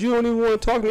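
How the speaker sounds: a son chasing his father, calling out hurt and loud; yelling at dad. Retold or imitated. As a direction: shout after him as he walks off. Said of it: "I said".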